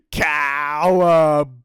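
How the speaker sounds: loud, slow pouncing cry; gleeful whoop. Acted. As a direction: shout it as you leap. Dingo pounces.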